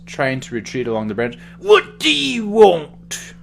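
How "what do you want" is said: put-on high, slow, strained old man voice. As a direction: wheeze it out like a frail old man. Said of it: "partially the old man voice".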